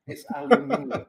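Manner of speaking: "aluminium" laughed out high and loud, al-yoo-MIN-ee-um; playful high laughing "aluminium", British style. Shown as a demonstration. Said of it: "so I have to say that".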